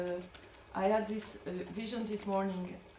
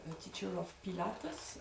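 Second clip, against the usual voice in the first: ordinary delivery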